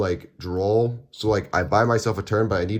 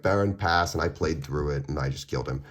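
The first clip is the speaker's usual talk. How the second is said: ordinary talk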